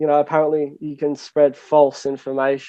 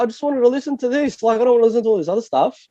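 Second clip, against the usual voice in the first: higher-pitched, quicker mock protest; exasperated retold complaint; 7 semitones higher, faster than usual. Listener grumbling at a shuffled queue, exasperated. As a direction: whine it in frustration, speeding up. Retells frustrated reaction to queue.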